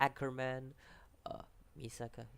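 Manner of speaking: ordinary delivery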